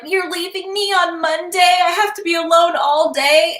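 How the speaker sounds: high-pitched, sing-song mock upset; imitating getting mad. Wife to husband leaving for work, mock mad. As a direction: complain in a put-on, wailing protest. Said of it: "me getting mad".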